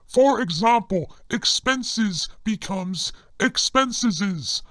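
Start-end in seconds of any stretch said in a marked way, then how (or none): 1.3-4.6 "expenseses" with an extra -es syllable, slowly; slow "expenses becomes expenseses"; extra S ending. Shown as a demonstration. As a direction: stretch out "expenseses" with the doubled S. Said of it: "add another S onto the end".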